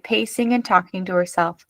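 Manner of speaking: plain reading voice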